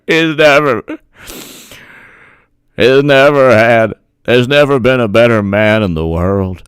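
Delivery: lightly voiced Graham Southern accent, fawning; mild Southern senator send-up, over the top; Lindsey Graham accent. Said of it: "That's what Lindsey Graham said".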